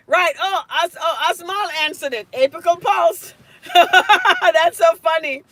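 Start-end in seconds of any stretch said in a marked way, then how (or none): 3.5-5.4 slow words through loud laughter; laughing. Real, not acted. Teacher amused by a student's answer, laughing loudly.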